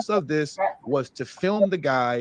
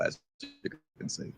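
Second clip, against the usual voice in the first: quiet aside; 6 semitones lower, 14 dB quieter than usual. Real, not acted. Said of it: "I'm getting a little emotional".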